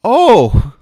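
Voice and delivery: high, loud burst of delight. Acted. New dad seeing his baby, high delighted outburst. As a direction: gush over the newborn.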